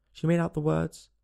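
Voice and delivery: usual speaking voice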